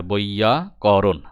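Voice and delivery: বৈয়াকরণ slowly, in parts: boi-ya, ko-ron; slow teacher's sounding-out of বৈয়াকরণ; pronunciation demo. Shown as a demonstration. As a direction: stretch বৈয়াকরণ out as boiya... koron. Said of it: "আমরা যেভাবে উচ্চারণ করি".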